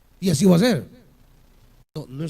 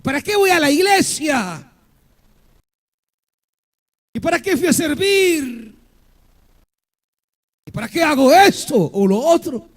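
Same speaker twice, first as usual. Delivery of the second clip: discouraged believer complaining; 3 semitones higher, 4 dB louder than usual. Retold or imitated. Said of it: "comienzan a decir algunos".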